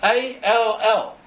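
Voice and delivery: slow letter-by-letter spelling; drawn-out letters, slowly driven home. Real, not acted. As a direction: spell "A L L" deliberately, each letter separate. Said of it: "Let me spell that".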